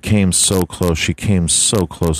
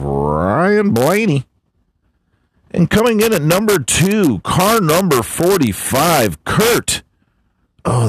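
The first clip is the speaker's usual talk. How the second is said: hammed-up race announcer; 6 semitones higher than usual. Reading the NASCAR top finishers to his buddy, hyped announcer. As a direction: boom out the countdown like a race caller. Hams up the race results countdown.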